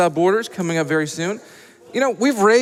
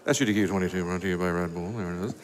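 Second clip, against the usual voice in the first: much lower, quieter mock sponsor announcer; deep announcer voice; 14 semitones lower, 9 dB quieter, faster than usual. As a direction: drop into a deep, hushed ad-read voice.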